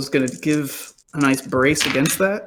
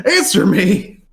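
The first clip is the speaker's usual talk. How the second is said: crazed shout; 6 semitones higher, 5 dB louder, slower than usual. Acted. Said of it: "and he goes".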